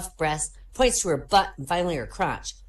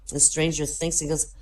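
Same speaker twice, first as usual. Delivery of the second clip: ordinary talk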